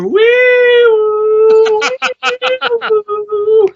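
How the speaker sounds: high piercing wee woo siren imitation; siren noise. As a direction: imitate a fire truck siren, high and loud. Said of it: "She was going".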